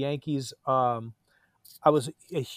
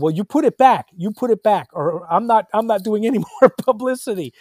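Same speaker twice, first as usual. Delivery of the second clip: much higher, louder mock demand; retold ultimatum; 7 semitones higher, 8 dB louder than usual. Actor threatens director over cut scene, loud demand. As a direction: lay down the ultimatum. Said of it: "And I said".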